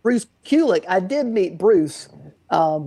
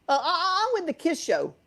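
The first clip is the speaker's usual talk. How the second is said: put-on New York accent; 10 semitones higher, 4 dB quieter than usual. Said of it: "his accent was just getting the best of me".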